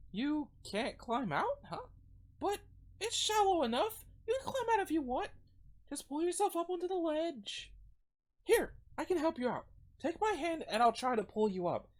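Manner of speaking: put-on high-pitched, encouraging girl voice; Ava. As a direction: coax in a high girlish voice, offering help. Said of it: "trying not to give her generic high-pitched girl voice".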